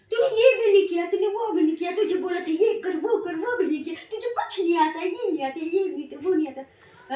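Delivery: mock-furious, tearing into him; imitated angry tirade; angry scolding. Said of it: "ऐसे गुस्से में आके".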